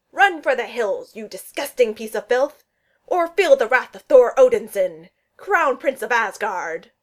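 loud, grandiose warrior's threat; roaring. A narrator's reading. Thor tries to strike terror into Steve, roaring. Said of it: "roared in Steve's face".

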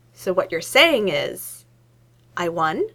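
slow, drawn-out realization; slow dawning triumph. A narrator's reading. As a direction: savour the victory slowly.

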